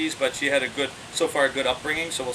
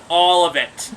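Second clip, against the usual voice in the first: firm, louder command; mild tough dad order; stern dad; 2 semitones higher, 8 dB louder, slower than usual. Retold or imitated. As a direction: lay down the law to the monkey. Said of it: "the tough dad".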